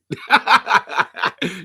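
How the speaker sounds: loud high burst of laughter; real laugh. Real, not acted. Host planning to ask about [name], laughing hard. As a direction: laugh hard in quick pulses. Laughs at own bold question.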